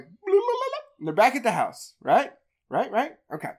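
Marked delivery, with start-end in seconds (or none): 0.0-0.8 very high mock babble; dismissive blah. The group chattering at home, mocking blah blah much higher. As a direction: brush it off as meaningless noise. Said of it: "They're like".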